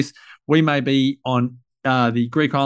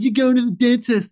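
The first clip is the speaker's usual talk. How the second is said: put-on taunting kid; 9 semitones higher than usual. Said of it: "He's like".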